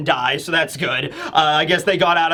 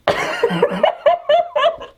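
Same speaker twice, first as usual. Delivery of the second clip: helpless high-pitched cackle; real burst of high laughter; high laughing fit. Real, not acted. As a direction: crack up laughing. Cracks up at daughter theory.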